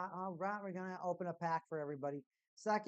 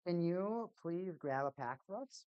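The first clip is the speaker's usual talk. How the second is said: ordinary talk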